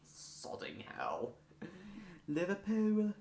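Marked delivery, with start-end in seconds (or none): 2.3-3.1 high-pitched practice stab at Scouse; amateur Scouse, a little Welsh; Liverpudlian accent attempt. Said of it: "if that was Welsh or Liverpudlian".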